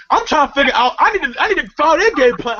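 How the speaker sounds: normal talking voice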